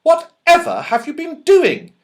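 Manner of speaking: shocked at the bruises; astonished mother. Acted. Mother astonished at the children's bruises, high alarmed voice. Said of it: "Mother was astonished".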